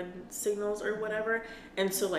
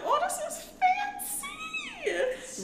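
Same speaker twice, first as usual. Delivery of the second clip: fancy voice; 4 dB louder, much slower than usual. Retold or imitated. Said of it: "I was like".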